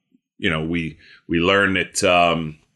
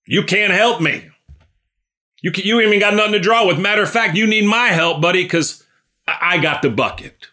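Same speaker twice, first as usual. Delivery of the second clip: higher-pitched, mock-indignant comeback; scornful retort; 9 semitones higher than usual. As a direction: act out a woman dismissing useless help, voice pitched up. Preacher acts woman's scornful retort to Jesus.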